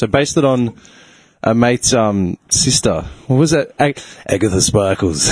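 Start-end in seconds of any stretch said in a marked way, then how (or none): 4.3-5.3 lightly voiced drag queen, pitched low. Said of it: "used to have a drag queen voice".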